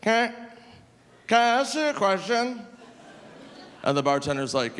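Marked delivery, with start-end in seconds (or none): 0.0-2.6 put-on high, halting drunk voice; drunk guy asking. Drunk man asks the bartender a question, high and halting. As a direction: lean in like a sloshed stranger. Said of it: "he goes".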